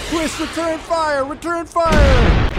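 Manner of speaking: war correspondent under fire; alarm shout. Pretend. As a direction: yell it like shells are landing. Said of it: "would you like me to go back to being a war correspondent".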